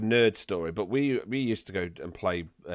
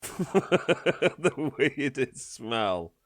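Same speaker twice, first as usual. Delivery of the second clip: laughing; 5 semitones higher, much slower than usual. Real, not acted. Host repeats question, laughing.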